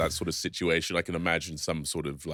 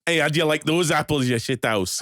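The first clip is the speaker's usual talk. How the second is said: high, loud Scottish taunt; mock Scottish accent; 10 semitones higher, 9 dB louder than usual. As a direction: jeer at a rival in a hammed-up Scottish accent.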